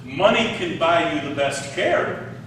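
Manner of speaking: normal talking voice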